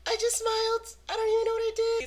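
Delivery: very high squeaky self-defense; hammed-up imitation of a flustered protest; mock protest. Retelling the excuse that made him laugh, high mock protest.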